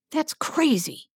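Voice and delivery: high, shocked disbelief; horrified. Acted. Adam hears Laurel wants Sovereign influenced, horrified. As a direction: recoil at the idea, appalled.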